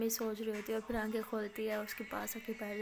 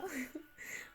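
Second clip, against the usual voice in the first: soft giggle; 5 semitones higher, 8 dB quieter than usual. Acted. Maya laughs mid-line.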